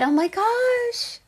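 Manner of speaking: high, slow, soft oh my gosh; breathless high wonder; delighted gasp. Real, not acted. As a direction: swoon at the news, drawn out high. Reacting to the reading with delight.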